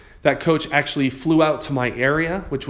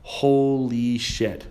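very slow, drawn-out "holy shit"; stunned inner thought; much slower than usual. Retold or imitated. Investor realizing offers were accepted, stunned and slow. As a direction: let the dread sink in, word by word. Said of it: "in the back of my mind".

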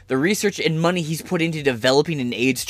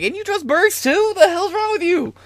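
much higher, put-on voice, disbelieving; mock disbelief, pitched way up; incredulous Magic. Magic Johnson scolding host for trusting Birx, high mock disbelief. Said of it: "he came and he was like".

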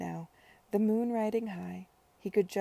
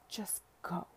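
soft, near-whispered, tearful restraint; hushed dismissal; 6 dB quieter than usual. A narrator's reading. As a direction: barely voice it, looking away in pain. Tearful woman sends him away.

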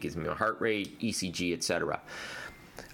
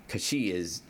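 ordinary delivery

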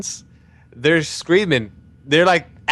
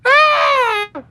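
mock squeaky cry; 4 dB louder than usual. Cry after the story.

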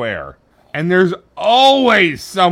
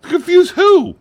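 incrédulo; 12 semitones higher, 7 dB louder than usual. Real, not acted. Incredulous comeback in film debate.

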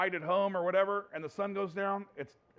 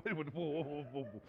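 soft alarmed backing off; 4 semitones lower, 10 dB quieter than usual. Retold or imitated. Startled husband backing off.

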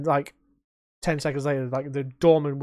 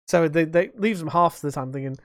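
ordinary talk

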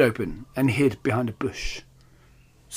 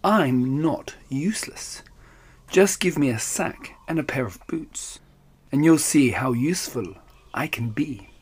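purring whisper. Acted. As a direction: coax him in a hushed purr. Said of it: "purred the cat".

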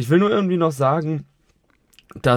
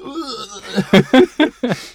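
high laugh; 10 semitones higher, 6 dB louder than usual. Real, not acted. Joke about overflowing beer.

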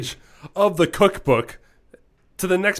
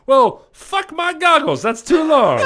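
loud exclamation; 5 semitones higher, 5 dB louder than usual. Acted. Punchline to chili question.

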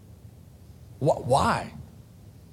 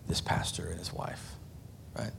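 hushed aside; 7 semitones lower, 13 dB quieter than usual. Real, not acted.

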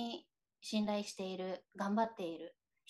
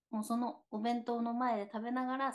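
ordinary delivery

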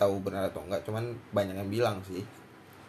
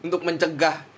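laughing; 7 semitones higher, 7 dB louder than usual. Real, not acted. Mock formal thanks, laughing.

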